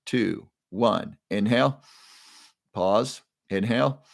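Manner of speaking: unhurried breath cues with pauses between; guided breathing cues. Shown as a demonstration. Leading the viewers through the breathing, paced cues. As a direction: count the group through each breath. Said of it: "so let's do it together".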